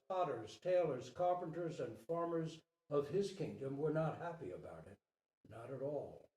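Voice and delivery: ordinary delivery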